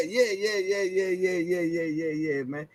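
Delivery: ordinary talk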